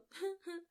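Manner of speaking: a quick bright burst of laughter; short laugh. Real, not acted. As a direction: laugh at the absurd scene. Laughing at the story.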